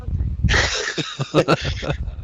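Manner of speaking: soft, breathy run of chuckles; laughing. Real, not acted. Wrestler laughing over his country gum trick, chuckling. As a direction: chuckle at your own trick.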